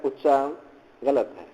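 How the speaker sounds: ordinary talk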